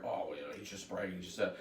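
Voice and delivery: low, soft dismissive mutter; mocking listeners. Host imitates doubters dismissing his stories, low and muttered. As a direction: brush it off under your breath.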